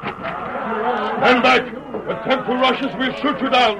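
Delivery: rising commanding shout. Acted. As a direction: warn them off at gunpoint. Guard threatens prisoners at door.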